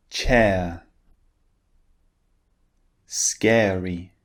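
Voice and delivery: "Air", "Chair", "Square" each said with a long vowel and no R; isolated teacher model of every word, long final vowel without R; model words for repetition. Shown as a demonstration. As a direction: say each word slowly and clearly for students to copy, R silent. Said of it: "listen and repeat after me".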